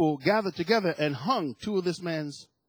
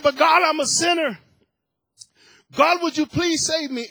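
louder, higher plea for rescue; old man retelling his cry for mercy, raised voice; pleading cry; 8 semitones higher, 6 dB louder than usual. Retold or imitated. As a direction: beg God to save him, acted in earnest. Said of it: "I cried out to God".

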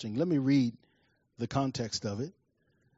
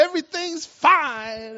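high, loud, drawn-out false assurance; comically frantic, high-pitched reassurance; panicked cover-up; 14 semitones higher, 7 dB louder, slower than usual. Acted. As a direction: insist too hard, hiding your panic. Panicked boy covering up to mother.

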